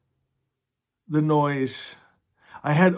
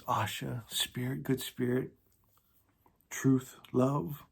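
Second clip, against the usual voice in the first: soft reverence; 5 semitones lower, 12 dB quieter than usual. Real, not acted. Naming God softly.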